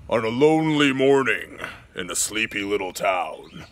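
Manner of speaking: dramatic storybook narrator, swelling loud. Shown as a demonstration. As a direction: build to a booming doom. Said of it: "That's my narrator voice".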